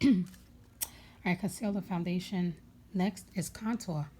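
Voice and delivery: ordinary talk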